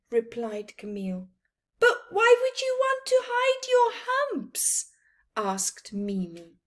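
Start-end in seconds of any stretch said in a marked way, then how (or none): 1.8-4.9 very high, squeaky questioning voice; little friend voice; high character voice. Acted. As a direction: ask in a tiny high voice.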